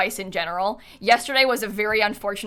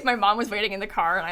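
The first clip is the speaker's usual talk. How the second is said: usual speaking voice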